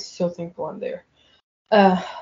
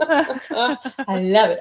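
laughing; 5 semitones higher, 4 dB louder, much slower than usual. Real, not acted. Laughing at husband's Alexa command.

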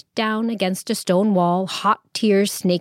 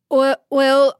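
louder, higher flustered well; embarrassed; 5 semitones higher, 5 dB louder than usual. Acted. Abu Hassan caught by all eyes, flustered and mortified. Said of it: "embarrassed horror".

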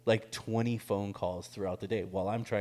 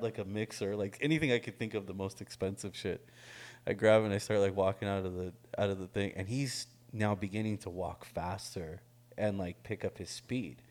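usual speaking voice

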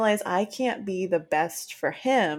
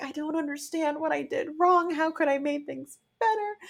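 higher, pleading imitation of past self; mildly hammed-up distress; mock distraught; 8 semitones higher than usual. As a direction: replay the helpless questions. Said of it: "And I was like".

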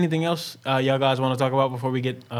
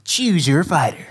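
slow, drawn-out arcade announcer; fighting game announcer. Pretend. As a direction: boom it like a game intro. Announcer intro to callers.

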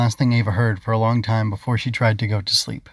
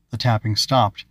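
plain reading voice

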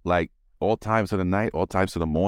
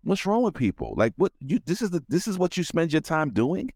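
exasperated; 7 semitones higher than usual. Retold or imitated. Retelling own exasperated reaction.

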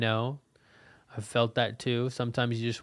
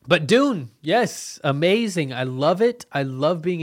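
higher, louder gushing; excited; 5 semitones higher, 6 dB louder than usual. Real, not acted. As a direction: rave about it eagerly. Gushing about favourite book.

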